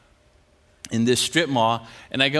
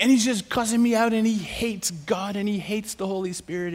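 ordinary delivery

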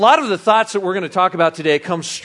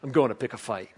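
low quiet movie quote; 6 semitones lower, 9 dB quieter than usual. Retold or imitated. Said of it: "His response".

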